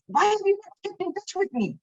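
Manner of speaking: a high, lightly voiced guru asking why; hint of a hurt teacher chiding; gentle reproach from guru. Guru on the phone chides his disciple, lightly voiced.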